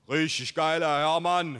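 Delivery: loud call from the buddy; rough-and-ready buddy. A narrator's reading. Buddy hailing his friend's father, loud rustic buddy voice. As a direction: hail him loudly from the doorway. Mike's voice from garage entrance.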